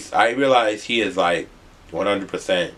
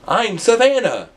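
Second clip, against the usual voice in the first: high, slow woman's voice; high, drawn-out young woman introducing herself; girl's voice; 11 semitones higher, 3 dB louder, slower than usual. Retold or imitated. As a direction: voice her bright self-introduction, much higher.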